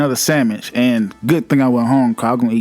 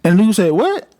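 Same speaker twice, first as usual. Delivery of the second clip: a lightly voiced, higher tough-guy Chris on the phone; talking like Chris; 5 semitones higher than usual. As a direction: act like the rude assistant manager Chris, a touch higher. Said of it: "I'm trying to talk like Chris".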